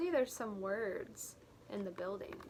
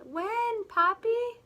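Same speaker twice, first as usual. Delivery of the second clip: put-on child asking; 11 semitones higher, 8 dB louder, slower than usual. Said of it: "He asks his dad".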